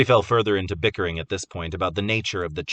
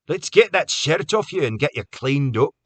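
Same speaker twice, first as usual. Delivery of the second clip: coaxing; 7 semitones higher, 6 dB louder than usual. A narrator's reading. Graham soothing Carter.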